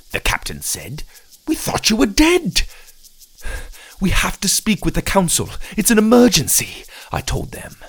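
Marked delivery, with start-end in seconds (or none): 1.5-2.7 very high, startled exclamation; astonished captain. Acted. 4.0-6.8 high, desperate appeal; urgent plea. Acted. Desperate demand to the guards.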